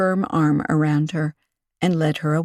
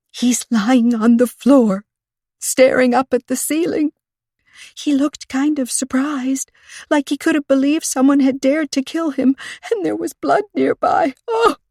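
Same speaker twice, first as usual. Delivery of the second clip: panicked account; 8 semitones higher, 4 dB louder than usual. A narrator's reading. Panicked witness describes the body.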